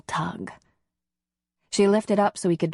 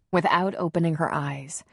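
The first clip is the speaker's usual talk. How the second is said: ordinary delivery, nothing marked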